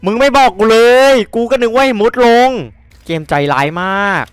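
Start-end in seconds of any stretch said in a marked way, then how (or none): 0.1-2.5 1 frustrated shout. Real, not acted. Complaining at game, loud.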